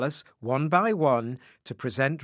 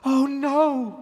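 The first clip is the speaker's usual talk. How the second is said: high, loud cry of dismay; Rose cries in dismay; 12 semitones higher, 5 dB louder than usual. Acted. Rose realises her mistake, with a high, loud cry of dismay. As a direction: cry out as the secret slips. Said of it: "cried Rose".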